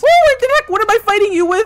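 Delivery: high, loud startled cry; surprised yell. Real, not acted. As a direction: caught off guard, shouting. Sudden shock mid-fight.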